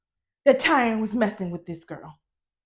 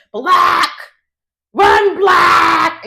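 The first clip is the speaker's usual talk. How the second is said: mock shout; 4 semitones higher, 8 dB louder, much slower than usual. Retelling a film man's cry, shouted. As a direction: yell it like the man in the film.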